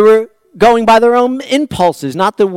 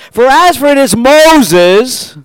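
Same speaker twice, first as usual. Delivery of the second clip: "this Moses" drawn out slowly and pushed louder; stress on this Moses; 6 semitones higher, 8 dB louder, much slower than usual. Shown as a demonstration. Preacher reading Exodus to the congregation, leaning hard on "this Moses". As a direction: lean hard on "this Moses", stretch it out. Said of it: "that was my emphasis".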